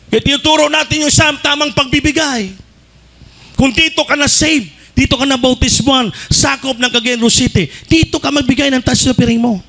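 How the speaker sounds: preaching at full force. Acted. Preacher exhorting the church to give in the right place, at full force. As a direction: drive the point home to the congregation.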